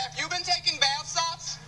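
normal talking voice

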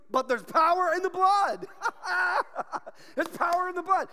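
high, loud joyful cry with laughter; exultant. Real, not acted. Preacher answering discouragement with the hymn, exultant.

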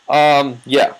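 ordinary talk, nothing marked

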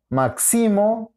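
"máximo" slow, stressed as mak-SI-mo with a high SI; stress on middle syllable. Shown as a demonstration. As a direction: move the accent of "máximo" onto SI. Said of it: "La ubicación del acento". («máximo» con acento en SI: mak-SÍ-mo)

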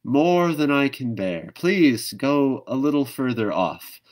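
high, loud, slow courtesy; overly polite show of praise. Acted. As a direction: kangaroo politely flattering the nightjar, high and loud. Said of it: "but out loud she said".

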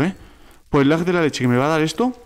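ordinary talk

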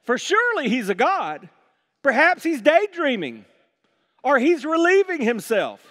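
high-pitched sarcastic taunt; mocking. Elijah taunting prophets about their silent god, high mocking tone. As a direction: needle them with mock-helpful suggestions. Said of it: "Elijah began mocking them".

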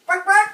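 loud high bark, bark; mock barking. Real, not acted. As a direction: bark out the words loudly. Reacting to sour candy.

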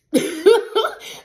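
a sudden, high-pitched laugh; high burst of laughter. Real, not acted. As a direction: laugh out loud, high and bright. Laughs at own joke.

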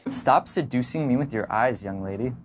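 low, teasing reprimand; deep, hushed flirtatious scolding; mock accusation. Shown as a demonstration. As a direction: accuse playfully in a dropped voice, softening. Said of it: "You might say".

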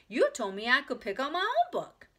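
put-on high, loud child protest; insistent, forgetting to whisper. A narrator's reading. Ramona argues in the library, loud and insistent. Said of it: "forgetting to whisper".

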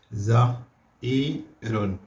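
زئير drawn out slowly; slow falling زئير; slow model word. Shown as a demonstration. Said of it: "هنا زئير".

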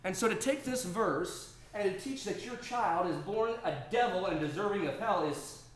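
loud, high, driving sermon voice; full-force preaching. Acted. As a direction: preach it hard to the congregation.

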